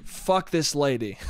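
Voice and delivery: normal talking voice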